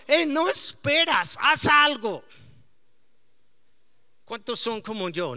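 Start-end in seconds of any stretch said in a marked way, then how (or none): 0.1-2.2 loud, pushy self urging; urgent self-talk. Retold or imitated. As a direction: push yourself to act now. Pastor voicing his own urging. (urgencia en voz alta)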